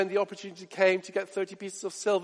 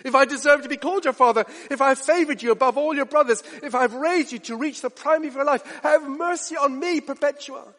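high, louder, faster plea; pleading father; 8 semitones higher, 4 dB louder than usual. Retold or imitated. Father begging daughter Perpetua not to abandon him, high urgent plea. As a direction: press the plea harder, rushing. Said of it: "burst out".

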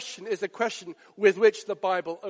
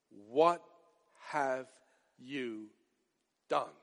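hushed, word by word; 9 semitones lower, 11 dB quieter, slower than usual. Acted. Preacher repeats the question, word by word.

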